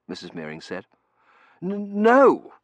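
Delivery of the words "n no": stammering. A narrator's reading. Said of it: "Terence stammered".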